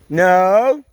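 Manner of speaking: loud high commands to dogs; calling dogs loudly. Real, not acted. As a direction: order the dogs into the kennel.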